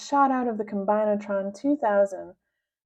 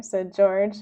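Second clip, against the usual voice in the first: plain reading voice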